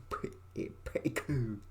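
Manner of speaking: faint, half-voiced murmur; breathy whisper. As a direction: let it fade to a whisper. Playful repeats of pretty cool.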